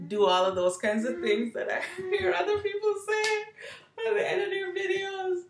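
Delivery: high laughing giggle through the words. Real, not acted. Laughing at generic outro lines.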